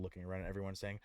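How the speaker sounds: ordinary delivery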